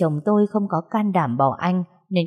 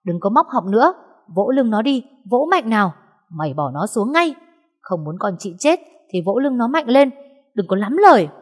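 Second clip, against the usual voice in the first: commanding shout; 4 semitones higher than usual. Acted. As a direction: bark orders to save the choking boy. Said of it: "gào to". (quát to, cao giọng ra lệnh gấp)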